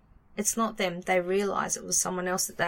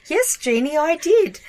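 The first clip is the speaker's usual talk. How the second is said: pitched way up, louder; high playful voice; 7 semitones higher, 7 dB louder than usual. Pretend. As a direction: squeak it out playfully. Answering as Siri.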